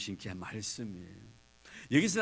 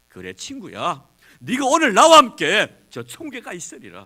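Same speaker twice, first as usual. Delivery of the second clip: promising proclamation; 9 semitones higher, 18 dB louder than usual. Acted. Jesus answers the robber.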